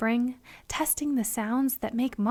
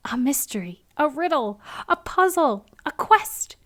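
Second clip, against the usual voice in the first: building excitement; 4 semitones higher, 5 dB louder than usual. A narrator's reading. Building list of wonders.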